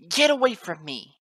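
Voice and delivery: high, very loud colt's shout. A narrator's reading. Colt driving off his father, high desperate shout. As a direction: shout him away in a high voice. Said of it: "Snip shouted".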